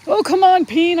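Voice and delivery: loud frustrated outburst; exasperated shout. Real, not acted. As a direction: yell out in annoyance. Bitten by bugs, exclaims.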